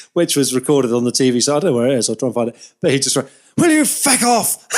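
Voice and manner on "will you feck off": high, slow Irish brogue, put-upon; Eddie Jordan's Irish accent. Retold or imitated. Eddie Jordan tells the flag-waving fan to go away, in a high, slow Irish accent. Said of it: "in his best Irish accent".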